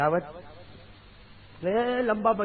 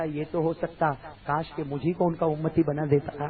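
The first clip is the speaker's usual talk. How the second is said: normal talking voice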